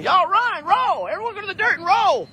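high, urgent mock warning; voicing the hen. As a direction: play the bird telling everyone to flee. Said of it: "she's telling everyone".

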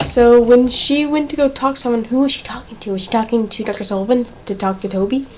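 ordinary talk

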